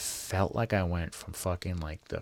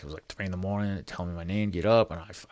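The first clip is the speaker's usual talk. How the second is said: usual speaking voice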